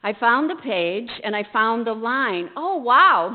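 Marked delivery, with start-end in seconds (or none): 2.5-3.3 surprised. Acted. Sees an impossible line.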